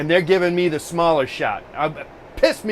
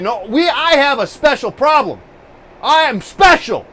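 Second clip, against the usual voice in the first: mock indignation; 8 semitones higher, 6 dB louder than usual. Real, not acted. Mock self-pity about tech support.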